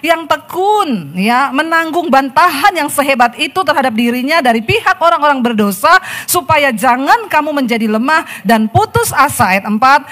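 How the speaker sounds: loud, high fervent scripture reading; fervent preacher voice; preaching at full force. Acted. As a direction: proclaim the verse to the congregation.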